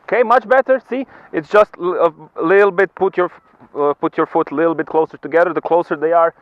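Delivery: high, loud coaching calls; cheery ski instructor shouting. Real, not acted. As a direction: shout tips across the snow. Instructor calling to skier on slope.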